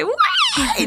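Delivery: very high laughing whoop; excited cheer. Retold or imitated. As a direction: cheer when the song drops. Retold cheer at finale.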